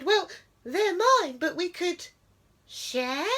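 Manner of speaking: high, trembly little mouse voice; nervous quiver. A narrator's reading. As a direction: quaver it like a scared mouse. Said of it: "nervously she quivered".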